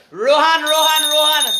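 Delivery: high, loud repeated name call. Real, not acted. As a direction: hail a listener at the top of your voice. Host calls out to a viewer.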